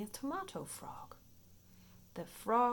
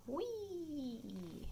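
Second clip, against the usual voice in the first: playful whee; 7 semitones higher, 5 dB quieter than usual. Acted. Frog jumping, playful cry.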